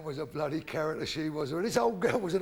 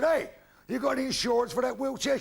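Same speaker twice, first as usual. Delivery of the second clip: high-pitched shouted challenge; challenging call-out; 8 semitones higher, 4 dB louder than usual. Acted. Man stops old woman for her insurance, calling high. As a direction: holler it high, like an ambush.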